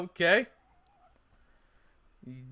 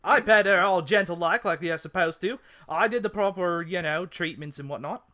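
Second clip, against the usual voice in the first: muddled Cockney-Australian telling-off; attempted Cockney. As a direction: complain in an amateur Cockney accent. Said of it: "I was trying to go Cockney".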